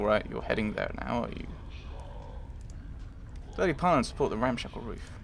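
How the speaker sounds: ordinary delivery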